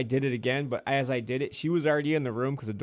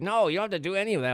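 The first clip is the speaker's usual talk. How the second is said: rushed backpedaling; 10 semitones higher, faster than usual. Retold or imitated. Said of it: "he's like".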